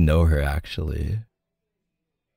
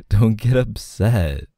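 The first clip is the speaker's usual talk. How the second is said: ordinary talk, nothing marked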